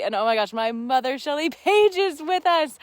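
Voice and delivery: loud, delighted burst of news; thrilled to tell viewers her mother joined; excited announcement. Real, not acted. Host telling live viewers her mother has joined, loud and delighted.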